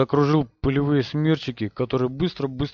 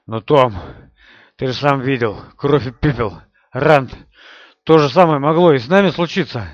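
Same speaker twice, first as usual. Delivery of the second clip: agitated; 8 dB louder than usual. Acted. Matt insisting, agitated.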